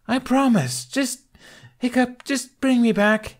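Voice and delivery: very high, loud desperate plea; pleading. Acted. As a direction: beg over the roaring wind. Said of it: "she pleaded against the high winds".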